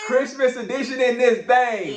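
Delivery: high, loud hype-man call; hyped announcement. Acted. Dad echoing his daughter's Christmas episode title, high hyped shout.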